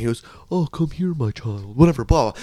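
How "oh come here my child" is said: soft cod-priest call; shitty priest voice. Retold or imitated. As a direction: lightly put on a priest's beckoning. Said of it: "a shitty priest voice".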